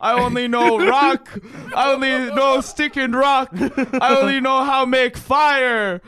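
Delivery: caveman voice. Pretend. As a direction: grunt it out like a caveman. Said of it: "I'm a caveman".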